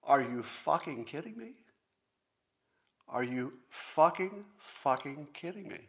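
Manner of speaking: can't-believe-it exasperation; exasperated cursing. Real, not acted. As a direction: let the outrage spill into the swear. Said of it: "I'm gonna add a curse word".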